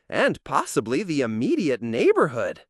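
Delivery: ordinary talk, nothing marked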